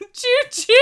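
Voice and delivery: high, loud choo-choo whistle; chugging toy train voice. Pretend. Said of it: "That's not a train sound".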